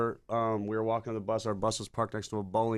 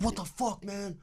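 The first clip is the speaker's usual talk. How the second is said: high-pitched imitation of panic; freaking out; 8 semitones higher, 4 dB quieter, slower than usual. Guy with guitar case sees them, freaking out. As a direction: act out a bewildered, panicky stranger. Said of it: "he's freaking out".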